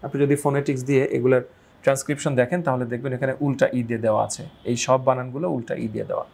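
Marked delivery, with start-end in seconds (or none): none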